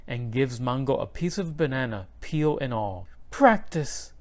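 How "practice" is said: Abuela's sly answer. A narrator's reading. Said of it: "she says".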